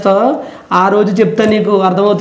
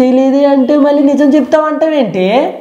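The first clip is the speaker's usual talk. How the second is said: higher, exasperated protest; raised voice; 5 semitones higher than usual. Real, not acted. Boyfriend denies messaging anyone, exasperated raised voice. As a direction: protest with the pitch pushed up. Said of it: "మెల్ల మాట్లాడు".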